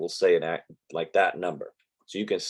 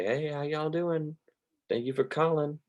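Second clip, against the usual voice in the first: put-on Southern accent; 8 semitones higher, 5 dB quieter than usual. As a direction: imitate a sweet Southern call-center operator, pitched up. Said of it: "a lot of people with that Southern accent".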